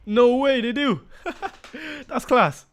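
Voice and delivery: high, loud burst of delight with a laugh; can't believe it, thrilled and laughing; excited disbelief. Real, not acted. New player images revealed in game.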